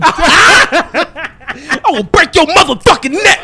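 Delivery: slow, high-pitched mock threat. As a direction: parody a furious threat, drawn out and hammed up. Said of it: "sound like [name]".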